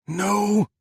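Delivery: high, loud cry of denial; anguished shout. Acted. Adam shouting at Faisal over the phone, high and loud. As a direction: scream it down the line. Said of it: "Adam shouted".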